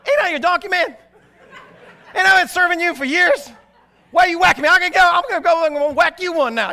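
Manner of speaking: high, loud cartoon donkey voice; a sassy talking donkey complaining to his rider; Eddie Murphy donkey. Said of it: "you can kinda hear Eddie Murphy".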